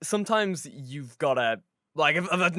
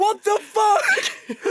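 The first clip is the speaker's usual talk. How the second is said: high, drawn-out scream breaking into laughter; loud, slow, hammed-up outburst; mock horrified shout; 7 dB louder, much slower than usual. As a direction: yell it in exaggerated disbelief. Horrified reaction to dog mauling, retold.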